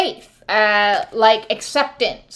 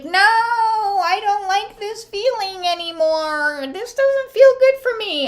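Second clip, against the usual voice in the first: high, drawn-out whiny voice; prim refusal, pitched way up; high, slow mock protest; 11 semitones higher than usual. Awakened person telling off old friends, high whiny protest. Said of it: "we go, like".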